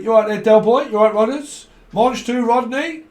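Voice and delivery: high, loud mock London geezer hello; hearty acted greeting. Greeting Del Boy and Rodney down the street, hammed-up and hearty. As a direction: call out a cheeky hail to old mates.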